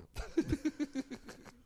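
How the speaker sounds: high, loud laugh; real laugh. Real, not acted. Guest laughing about winter up there, high loud laugh.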